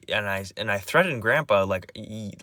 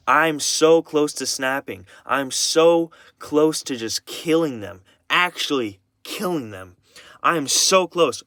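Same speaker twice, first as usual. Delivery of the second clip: slow, higher, heavily stressed venting; real anger; 4 semitones higher, 5 dB louder, slower than usual. Real, not acted. Venting about grandparents, close to snapping, really angry. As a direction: stretch each phrase out in anger. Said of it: "the fucking angriest I've been".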